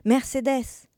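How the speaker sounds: bright-spirited hail, pitch lifted; joyful call. A narrator's reading. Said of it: "cria une voix joyeuse en dehors de la maison".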